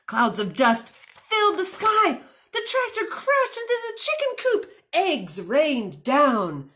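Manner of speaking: usual speaking voice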